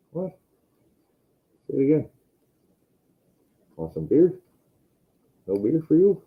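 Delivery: playful high question; teasing the cat. Real, not acted. Offering his cat beer, playful and teasing.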